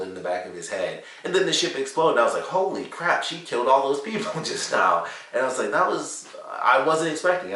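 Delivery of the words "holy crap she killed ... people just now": mock shock. Retelling own shock again.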